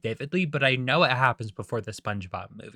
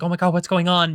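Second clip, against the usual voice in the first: louder, higher alarmed onlooker; mock commotion; 5 semitones higher, 5 dB louder than usual. Crowd outside the Krusty Krab reacts, mild mock alarm. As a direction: lightly act out a startled crowd. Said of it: "causing a commotion".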